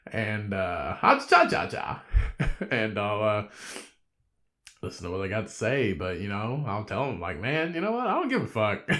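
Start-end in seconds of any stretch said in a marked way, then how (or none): 1.0-2.0 much higher playful chirp; silly high chirp. Pretend. Silly sung-out nonsense. 7.4-8.9 higher, breezy dismissal; mock breezy dismissal. Retold remark to complaining customer.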